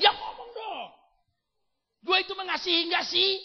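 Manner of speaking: high, loud shout of frustration; God demanding. Acted. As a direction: shout at them to speak up. God voiced challenging believer. (Tuhan berteriak menuntut)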